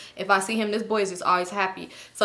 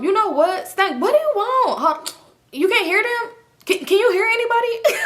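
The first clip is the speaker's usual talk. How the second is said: high loud fake confused call; 11 semitones higher, 4 dB louder than usual. Acting out loud call on phone.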